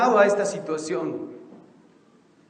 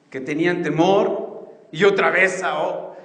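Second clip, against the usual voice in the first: normal talking voice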